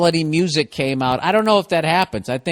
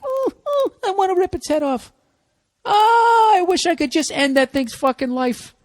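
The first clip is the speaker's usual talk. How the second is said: very high, squeaky crying dog voice; whiny dog voice; 12 semitones higher than usual. Acted. Host voices his crying dog over a squirrel, in a squeaky whimper. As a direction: whimper it out like a sobbing pup. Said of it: "Is it crying like".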